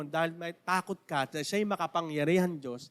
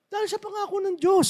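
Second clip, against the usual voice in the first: lightly voiced, higher, glad believer; joyful Mamberto; 12 semitones higher than usual. Mamberto explains his joy to the pastor, higher and glad. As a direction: say it with simple pride. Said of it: "sabi niya ganun".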